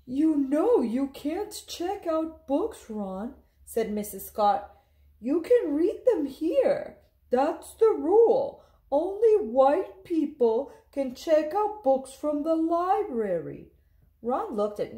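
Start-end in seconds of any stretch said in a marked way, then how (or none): 0.1-3.3 higher, slow and firm librarian voice; slow stern librarian. Acted. Librarian states the rule. 5.2-13.7 unbending librarian laying down the law; firm librarian. Acted. Librarian enforces segregation rule.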